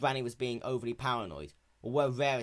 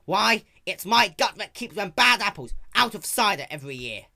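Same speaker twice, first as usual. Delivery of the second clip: put-on high, loud stubborn old mare; ranting old granny; 9 semitones higher, 12 dB louder than usual. A narrator's reading. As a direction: lecture them with folksy fury. Granny's long angry rant.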